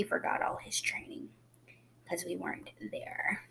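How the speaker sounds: hushed conspiratorial aside; whispering. Real, not acted. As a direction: drop to a whisper for the joke. Joking aside about kitten.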